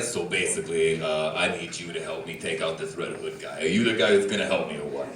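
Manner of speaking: very low, quiet villain voice; low, hushed menace of a movie villain; Joker. Acted. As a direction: drop low and soft to recruit a henchman. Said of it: "the Joker scene where he's like".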